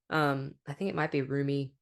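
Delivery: normal talking voice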